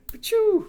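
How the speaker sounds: squeaky high "pachoo" stamp noise; stamp sound effect. Pretend. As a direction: shout a cartoon stamp sound.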